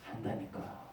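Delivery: whispered insistence; stubborn whisper. Acted. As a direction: insist under your breath. (속삭이듯 고집부리는 신자)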